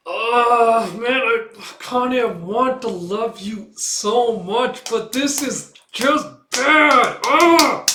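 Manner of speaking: loud, high, drawn-out exasperated wail; real frustration. Real, not acted. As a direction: groan it out at the top of your voice, slowly. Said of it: "I'm so frustrated".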